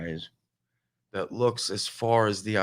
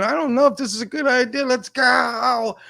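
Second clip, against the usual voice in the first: panicky; 12 semitones higher, 5 dB louder than usual. Retold or imitated. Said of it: "and I'm going".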